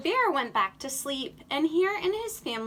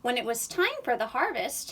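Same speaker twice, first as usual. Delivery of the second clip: usual speaking voice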